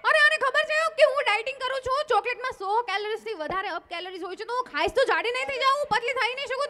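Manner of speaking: put-on angry girlfriend rant. Girlfriend ranting at boyfriend over the chocolate, angry. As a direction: tear into him, shrill with outrage.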